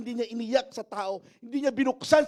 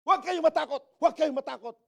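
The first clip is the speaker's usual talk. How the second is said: high-pitched mock bravado; pretend bravery; 6 semitones higher, 4 dB louder than usual. Moses acting brave before the people, high mock-brave call. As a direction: put on a brave front, voice pushed up. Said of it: "parang nagtatapang-tapangan".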